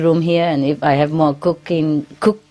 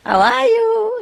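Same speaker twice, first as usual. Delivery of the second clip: high squawky parrot voice; talking bird; slower than usual. Retold or imitated. Teacher imitates her talking bird to the class. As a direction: chirp it up high like a pet bird. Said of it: "They even speak English, the birds".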